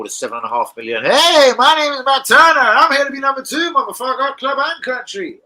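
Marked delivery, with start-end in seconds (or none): 1.0-5.4 high, loud, goofy put-on voice, slowing at the end; mock the new keeper with a silly squeaky voice; mock Matt Turner. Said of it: "Turner's up for it".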